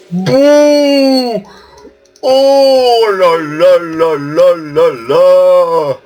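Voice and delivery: thrilled gamer cheering a kill; ecstatic cheering. Real, not acted. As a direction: shriek with joy at the kill.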